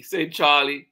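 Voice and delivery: ordinary delivery, nothing marked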